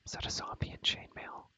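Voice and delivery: normal talking voice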